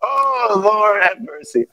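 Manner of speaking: soft, breaking "Oh, Lord have mercy"; real tears caught in the voice; choked up. Real, not acted. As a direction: overcome with real emotion. Preacher overcome mid-sermon.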